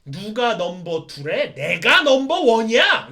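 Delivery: slow, loud, indignant shout; irritated yell. Acted. Satan Gundam snapping back at Knight Gundam, loud angry shout. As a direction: roar that you are number one. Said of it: "짜증을 내죠".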